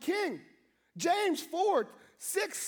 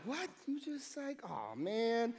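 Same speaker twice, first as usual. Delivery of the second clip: muted dismay; 4 semitones lower, 8 dB quieter, slower than usual. Retold or imitated. Walking away, muted dismay.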